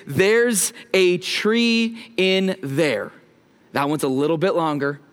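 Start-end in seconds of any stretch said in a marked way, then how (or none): 0.1-3.1 slow, deliberate title; title said slowly. Shown as a demonstration. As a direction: spell out "There's a Tree in There" word by word, slowly. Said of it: "it's the title of this morning's message".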